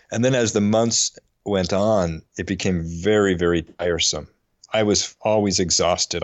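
ordinary talk, nothing marked

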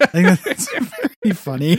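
laughing through the words; high, loud laugh-talk. Real, not acted. Laughing while talking.

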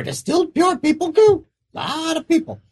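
high little creature; squeaky creature voice. Acted. Creature answers.

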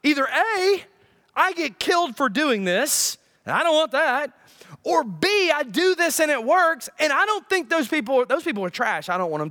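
high-pitched, indignant refusal; protesting Jonah. Acted. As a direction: argue back point by point as Jonah. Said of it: "Jonah's like".

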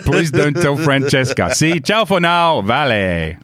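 slow, drawn-out mock-Italian sign-off; Italian accent. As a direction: sign off conspiratorially in put-on Italian English. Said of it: "try and do an Italian accent".

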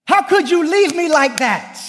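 high, accusing, retold hurt; a wounded seventh grader confronting his friend; hurt kid. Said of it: "I said".

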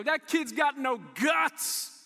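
loud jeering yell, drawn out; shouted schoolyard jeer; mocking kids' taunt. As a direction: taunt a little kid from a passing car. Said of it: "They go".

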